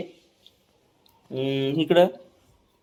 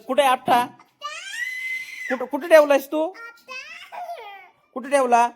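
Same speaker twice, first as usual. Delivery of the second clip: insistent questioning. Real, not acted. Dad asking screaming toddler where the cracker is, insistent.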